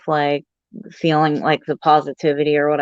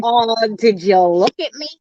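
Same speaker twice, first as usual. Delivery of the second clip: higher, indignant mock retort; 5 semitones higher than usual. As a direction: throw it back at them, offended. Mocking a rude commenter.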